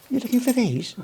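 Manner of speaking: high, sly teasing question. Retold or imitated. A copper teasing the lost driver with a high, sly question. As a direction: dangle it like a cheeky tease. Teasing Bear Grylls's dad with his keys.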